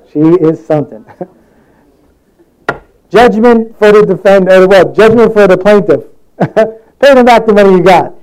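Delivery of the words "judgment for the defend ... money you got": higher, forceful mock verdict; Judge Judy. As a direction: hand down the ruling like a TV judge. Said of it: "Judge Judy, she's, she gets you".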